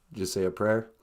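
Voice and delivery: put-on low, playful old-lady voice answering from upstairs; Nana character voice. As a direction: drop way down to play Nana. Nana voice continues.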